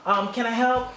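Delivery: overly chipper fake American accent; mock American accent. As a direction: mimic an eager American hotel clerk. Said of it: "the American accent comes in".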